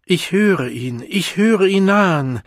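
loud chanted cry, climbing high; crowd roar. A narrator's reading. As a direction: build to a shouted rapture. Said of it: "Alle brüllten wie aus einer Kehle".

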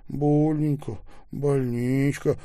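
lightly acted weeping complaint; crying, acted. A narrator's reading. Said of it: "плакал Таляпа". (жалобно, будто плача)

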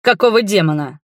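irritated, forceful demand; barked. A narrator's reading. Familiar voice demanding an explanation, barking. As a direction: bark out the oath. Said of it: "рявкнул знакомый голос".

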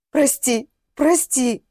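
strained, tearful pleading; mild sobbing apology. A narrator's reading. Remorseful father apologises to woman he hit, mild sobbing. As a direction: beg forgiveness, a touch of a sob. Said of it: "всхлипывал он".

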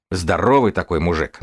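normal talking voice

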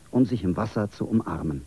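plain reading voice

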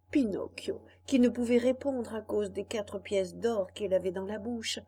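ordinary delivery, nothing marked

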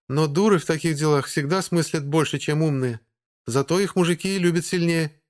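high, smirking female voice; sly vampire lady lecturing; vampire woman voice. A narrator's reading. Vampire Margo character voice.

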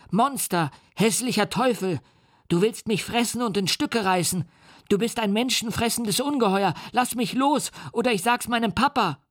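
put-on high, panicked child's voice; a terrified boy shrieking insults; screaming child. A narrator's reading. The captured boy screams at the monster, shrill and panicked. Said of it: "schrie er".

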